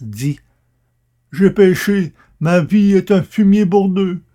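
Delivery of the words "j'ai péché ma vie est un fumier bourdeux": high, loud anguished confession; cry of anguish. A narrator's reading. Akab confessing to the prophet, a loud anguished cry. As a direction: cry it out in terror and guilt. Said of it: "poussant un cri d'angoisse".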